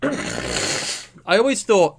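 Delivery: croaky voice catching on the words; throat catching, slow and croaky; frog in throat. Real, not acted. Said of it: "excuse me".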